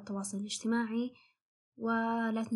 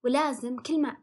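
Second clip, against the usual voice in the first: ordinary talk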